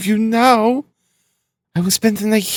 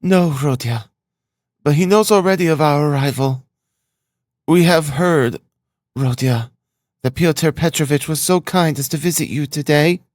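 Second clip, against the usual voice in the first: higher-pitched, tentative mother; somewhat timid; 9 semitones higher than usual. A narrator's reading. Mother mentioning a visitor to her touchy son, mildly timid. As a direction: add it carefully in short pieces. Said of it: "adds somewhat timidly".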